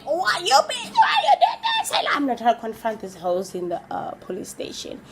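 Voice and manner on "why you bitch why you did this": confronting shout. Retold or imitated. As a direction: scream at them half laughing.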